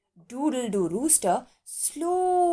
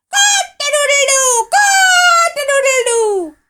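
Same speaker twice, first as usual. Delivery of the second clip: high, loud drawn-out crowing; calling out aloud; 13 semitones higher, 10 dB louder than usual. A narrator's reading. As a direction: crow as loud as you can from hiding. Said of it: "kept calling out".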